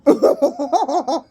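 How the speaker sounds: high, loud burst of laughter; laughing fit. Real, not acted. Reader pausing the video, bursting with laughter.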